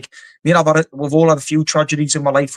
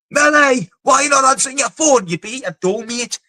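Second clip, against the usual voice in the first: calling out; 10 semitones higher than usual. Retold or imitated. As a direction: yell his name down the phone, fed up. Retold calling friend on phone.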